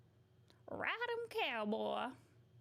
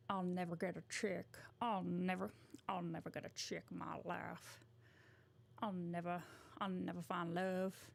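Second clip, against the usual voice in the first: Johnny Bravo voice; 3 semitones lower, 4 dB quieter than usual. Acted. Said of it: "he kinda sounds like [name]".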